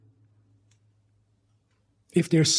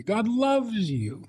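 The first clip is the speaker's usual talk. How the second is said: mock soothing; 7 semitones higher, 3 dB quieter, much slower than usual. Repeating what everyone told her.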